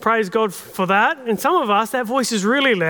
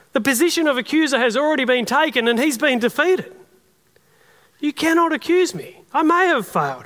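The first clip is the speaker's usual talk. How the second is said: defiant rebuke; 4 semitones higher than usual. Shown as a demonstration. Pastor shows how to answer the accuser, defiant rebuke. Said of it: "you can say".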